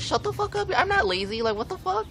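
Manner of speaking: very high squealing outburst; shrieking mock rage. Snapping back at the teacher, very high mock shriek. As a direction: squeal it in exaggerated fury. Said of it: "I'm like".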